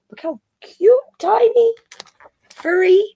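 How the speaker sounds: squealing high over the tiny cub; gushing cute voice. Real, not acted. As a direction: melt over how cute it is. Gushing over the newborn panda.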